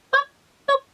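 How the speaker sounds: two quick boops for gunshots; gun sound effect. Shown as a demonstration. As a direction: mimic a toy gun firing. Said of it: "That's how my gun sounds".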